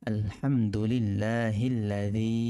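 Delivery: আলহামদুলিল্লাহিল্লাযী with a soft Arabic dh, tongue between the teeth, slow; soft dhal. Shown as a demonstration. Teacher shows the soft dhal sound in আলহামদুলিল্লাহিল্লাযী. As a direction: say the zal in আলহামদুলিল্লাহিল্লাযী softly. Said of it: "জাল এবং নরম".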